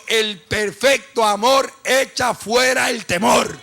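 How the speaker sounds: full-force preaching. Real, not acted. Pastor proclaiming scripture to the congregation, full-force preaching.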